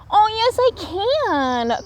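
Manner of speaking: high little raccoon insisting; eager cry. A narrator's reading. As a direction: insist like a small child. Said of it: "cried Little Raccoon".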